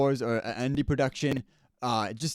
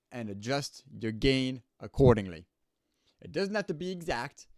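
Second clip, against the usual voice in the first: usual speaking voice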